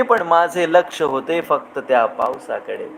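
lingering, lilting recital of verse; poem recitation. Acted. Host reciting a rain poem to his audience, lilting. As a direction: savour each line like falling rain.

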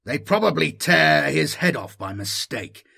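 a snapped answer to Grill's idea; snapping. Acted. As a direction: bite off the words with contempt. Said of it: "snapped Hackard".

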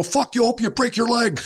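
mock heckler. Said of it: "and they go".

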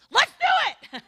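put-on high, eager little-boy go-for-it; kid's daring cheer. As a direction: pump it up like a reckless kid. Kid deciding to run.

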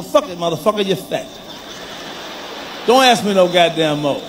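ordinary talk

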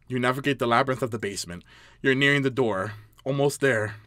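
low, hushed game narration; story narration. A narrator's reading. Player reading the basement scene, low story narration. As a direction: read it low and ominously.

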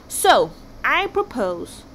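drawn-out, very slow propose; slow dramatic build-up. Acted. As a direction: stretch each word as if unveiling genius. King builds to his plan.

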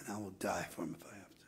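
hushed vow. Real, not acted. Intimate confession to congregation.

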